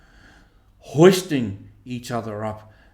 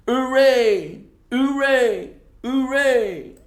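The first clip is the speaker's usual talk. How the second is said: high loud cheers of a crowd; distant cheering; 12 semitones higher, 6 dB louder, slower than usual. Acted. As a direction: cheer like a rowdy crowd overhead. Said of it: "shouting and cheering".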